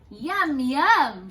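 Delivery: loud, high happy yum; yummy delight. Acted. Goldilocks tasting porridge, loud happy relish. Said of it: "she said".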